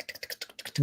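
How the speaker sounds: whispered rapid ticking; imitated plane rattle. Passenger imitates the plane body shaking, whispered ticks. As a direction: tick like a shaking airframe, all breath. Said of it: "body of the plane go tick".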